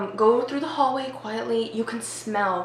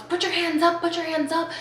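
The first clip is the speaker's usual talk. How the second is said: high-pitched mock cop command; retold police order; 7 semitones higher than usual. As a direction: imitate cops calling out, without full volume. Imitates cops shouting orders.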